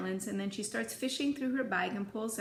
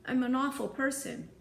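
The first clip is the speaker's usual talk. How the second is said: higher, mildly tearful confession; pitched up, a touch of crying; through tears; 5 semitones higher, 4 dB louder than usual. A narrator's reading. Girl confessing to her friend, lightly acted tears. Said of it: "she says through her tears".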